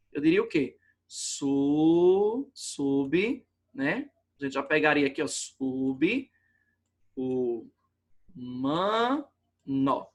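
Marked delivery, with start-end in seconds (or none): 1.1-3.5 "Su, sub" stretched out slowly by sound; syllable sound-out. Shown as a demonstration. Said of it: "separar pela pronúncia". 5.2-10.1 "sub, o, man, nó" in separate pieces, the b closing the first; wrong syllable split. Shown as a demonstration. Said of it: "separar pela pronúncia".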